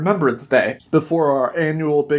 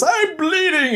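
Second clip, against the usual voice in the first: high-pitched, hammed-up whine; mock wailing complaint; 12 semitones higher than usual. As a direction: play the injured whiner, voice pitched way up. Injured Jack complains while being towed.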